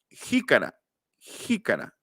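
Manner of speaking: jícara with a rasping j from the soft palate; velar j. Shown as a demonstration. Teacher modelling jícara's velar j. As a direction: scrape the j in jícara at the back. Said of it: "aquí se pronuncia la J".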